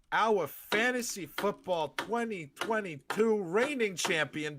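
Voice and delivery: slow, grand mock-announcer build-up; announcer introduction. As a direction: present the guest like a reigning champion.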